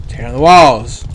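loud declaring shout. Acted. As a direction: bellow it like a battle cry. Shouting game line.

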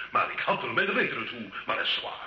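ordinary delivery

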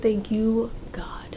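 soft whispered gratitude; whispered thanks. Real, not acted. Thanking God for the room, soft whisper. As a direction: whisper a thank-you prayer.

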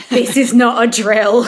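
hammed-up urgent command; dramatic urgency. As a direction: imitate someone leaping into emergency mode. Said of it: "gotten quite dramatic about all of this".

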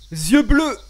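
forced out mid-cough, loud; choking. Real, not acted. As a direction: push the words through a cough. Still choking after a drink.

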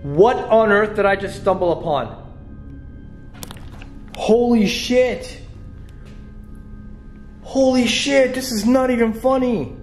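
real panic, calling out; panicked high voice. Real, not acted. Alone and scared, calling friends.

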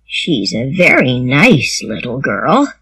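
low-pitched, unhurried man; slow, quiet man. A narrator's reading. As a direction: say it slowly and thoughtfully, low. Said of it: "said Matthew slowly".

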